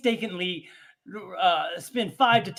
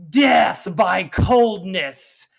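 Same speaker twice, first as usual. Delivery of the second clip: dramatic announcer; 6 dB louder, much slower than usual. Pretend. Dramatic segment host.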